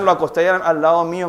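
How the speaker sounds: usual speaking voice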